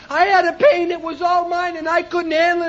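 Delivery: normal talking voice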